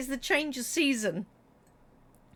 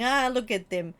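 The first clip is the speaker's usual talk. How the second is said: put-on lightly Russian-accented scornful rant; mock Putin rant. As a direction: sneer at the West like a lightly voiced dictator. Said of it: "Just sitting there going".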